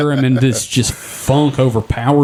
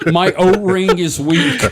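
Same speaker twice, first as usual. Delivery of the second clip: strained mock pleading; 6 semitones higher, slower than usual. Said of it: "I'd be like".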